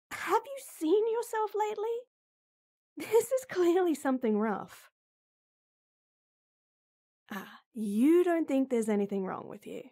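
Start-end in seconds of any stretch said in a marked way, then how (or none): 0.1-4.9 high, louder exasperated concern; high alarmed reproach; worried scolding. Acted. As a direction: scold him out of worry.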